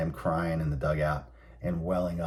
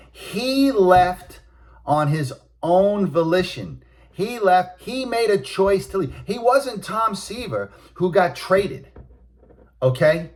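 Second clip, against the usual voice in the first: much higher, louder, hammering each phrase; indignant rant; 11 semitones higher, 5 dB louder, slower than usual. Real, not acted. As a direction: spit out the outrage, he chose to leave. Ranting that the player left.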